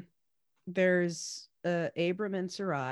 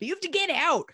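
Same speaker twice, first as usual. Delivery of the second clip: mock shout; 10 semitones higher, 6 dB louder than usual. Pharaoh expelling Abram again.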